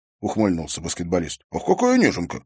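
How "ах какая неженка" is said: high-pitched teasing; smirking mock sympathy; mocking coo. A narrator's reading. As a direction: tease him as a softie, pitching up high. Athlete smirks and mocks the boy.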